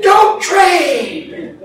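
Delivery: high, loud shouted warning; audience yell. Acted. Other audience members warning the contestant, high yell. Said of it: "other ones were yelling".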